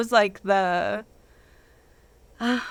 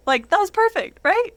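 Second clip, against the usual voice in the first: excited; 10 semitones higher, 4 dB louder than usual. Real, not acted. Excited recollection of perfect moment.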